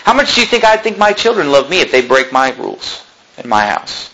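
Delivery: normal talking voice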